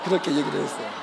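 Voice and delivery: ordinary delivery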